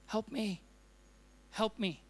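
soft, quiet, desperate "help me"; hushed pleading prayer. Retold or imitated. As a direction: plead quietly as if kneeling by the bed. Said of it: "Fall down by the side of my bed and just cry".

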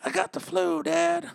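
very high put-on boy voice; cartoon kid telling his father he's sick; Bobby. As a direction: squeak it up like a little son. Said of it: "Talking like fucking Bobby from fucking, um, King of the Hill".

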